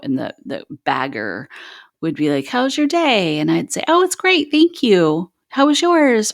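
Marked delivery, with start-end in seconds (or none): 2.5-3.4 high-pitched cheery greeting; chirpy small talk. Retold or imitated. Bagger making small talk with a customer at checkout, chirpy. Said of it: "happy fake tone". 3.9-6.3 very high-pitched, chirpy politeness; happy fake tone. Shopper answering the grocery bagger politely, happy fake tone. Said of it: "See that happy fake tone".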